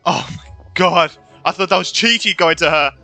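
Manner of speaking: ordinary talk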